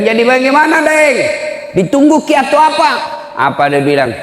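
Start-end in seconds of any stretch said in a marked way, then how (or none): 0.0-2.9 high, insistent retold demand; exasperated question. Preacher on phone pressing bereaved son, exasperated. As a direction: press him with a raised, high voice.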